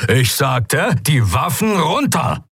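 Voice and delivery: slow, high, emphatic command; energetic order. A narrator's reading. Commander repeats his ignored order to pirates, emphatic and drawn out. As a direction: repeat the order forcefully, drawing it out. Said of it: "klang ziemlich energisch".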